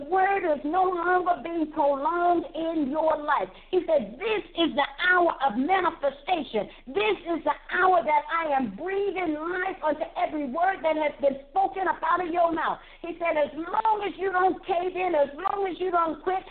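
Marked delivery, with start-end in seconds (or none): none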